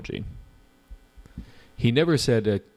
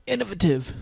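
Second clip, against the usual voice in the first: "Innovative" in a playful, much higher posh-British put-on; British accent; 10 semitones higher than usual. Shown as a demonstration. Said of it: "Hanan loves my British accent".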